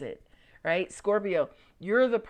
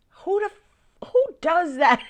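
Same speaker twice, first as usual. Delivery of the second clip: high, slow disbelieving cry; incredulous at herself; 9 semitones higher, 9 dB louder than usual. Real, not acted. Mocking herself for researching at 2am, high and incredulous. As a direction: pitch it up in disbelief at yourself.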